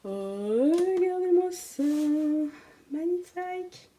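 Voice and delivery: high, drawn-out cooing over a treasure; gushing admiration. Real, not acted. Booktuber showing viewers a gorgeous book, gushing in awe.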